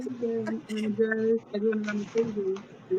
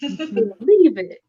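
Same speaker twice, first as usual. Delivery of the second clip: loud, drawn-out anime catchphrase; slow, punchy mock-heroic cry; catchphrase quote; 6 dB louder, much slower than usual. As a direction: proclaim it like a cartoon hero. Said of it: "I actually miss him saying".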